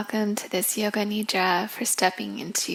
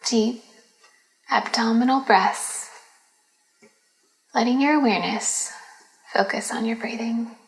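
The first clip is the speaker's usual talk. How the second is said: guided meditation; 6 dB louder than usual. Acted. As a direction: lead them gently into relaxation, phrase by phrase. Leads a yoga nidra.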